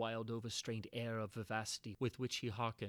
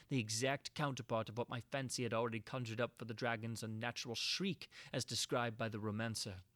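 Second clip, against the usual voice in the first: plain reading voice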